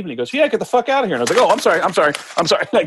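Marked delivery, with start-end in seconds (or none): 0.3-1.1 high-pitched, offhand brush-off; mock dismissive. Boss asked if he should leave, mock dismissive. 1.4-2.6 high, tumbling mock apology; flustered apology. Newcomer told to get out, acting out flustered apology.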